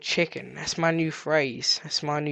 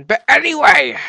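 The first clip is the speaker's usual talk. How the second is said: loud, higher-pitched burst; 7 semitones higher, 10 dB louder than usual. Real, not acted. Player brushing off the missing villagers, loud and high. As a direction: belt it out to move on. Loudly changes the subject.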